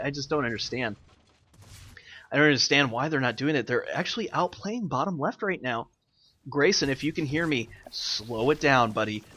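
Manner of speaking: ordinary talk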